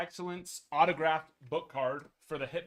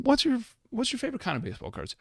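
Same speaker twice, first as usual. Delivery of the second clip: higher, louder put-on interviewer voice; mock interviewer; 9 semitones higher, 8 dB louder than usual. Host asking himself a question in a goofy, higher voice.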